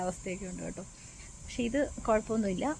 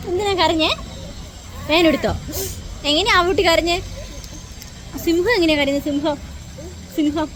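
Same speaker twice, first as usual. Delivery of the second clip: put-on higher, louder playful questions to a toddler; baby talk; 7 semitones higher, 6 dB louder than usual. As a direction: ask the little one like a game. (കുഞ്ഞിനോട് കൊഞ്ചി ചോദിക്കുന്നു)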